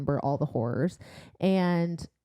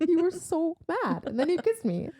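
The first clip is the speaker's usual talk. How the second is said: teasing; 10 semitones higher than usual. Retold or imitated.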